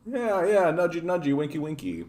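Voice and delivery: high, playful sing-song teasing; coy mock wink. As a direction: tease it like a sly wink. Mocking a coy politician.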